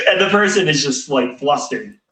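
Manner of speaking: ordinary talk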